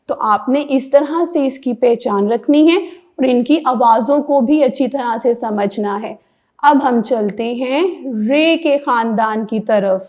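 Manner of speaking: normal talking voice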